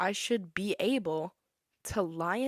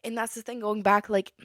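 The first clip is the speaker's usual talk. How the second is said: ordinary talk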